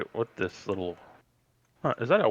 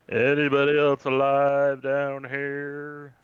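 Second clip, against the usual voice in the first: slow, drawn-out call, louder; yelling out; 3 semitones higher, 5 dB louder, much slower than usual. Acted. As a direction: call out into an empty house, stretching the words. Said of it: "At the top of his lungs, Sam yells out".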